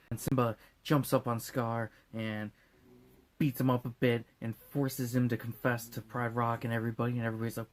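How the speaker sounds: usual speaking voice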